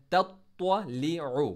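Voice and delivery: तत्वलिउ slow with a thick heavy त्व; heavy twa. Shown as a demonstration. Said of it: "त्वा को मोटा heavy letter". (तत्वलिउ में त्वा मोटा)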